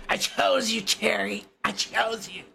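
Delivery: a hint of Trump, playful; Trump impression. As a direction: remind him who picked him, mockingly. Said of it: "My, my Trump is bad".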